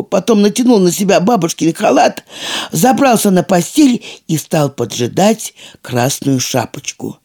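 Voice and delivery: ordinary delivery, nothing marked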